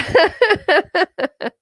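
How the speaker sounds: a high burst of laughter at her own joke; bright high laugh. Real, not acted. Speaker laughing at her own cheeky hint, high laugh.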